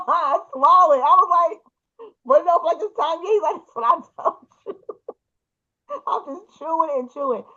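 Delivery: high-pitched, giggly retelling; can't keep from cracking up as you quote; laughing through the retelling. Real, not acted. Retelling a chat she found hilarious.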